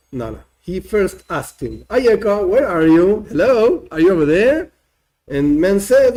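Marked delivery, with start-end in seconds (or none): 1.9-4.7 calling out. Acted. God calling out to man.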